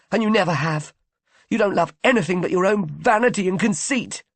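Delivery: high, loud jealous tirade, rising to a shriek on leave him alone; angry tirade. A narrator's reading. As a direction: tear into her without letting up. Jealous quarrel with Isa.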